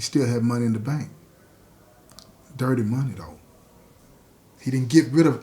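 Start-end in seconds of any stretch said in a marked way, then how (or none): none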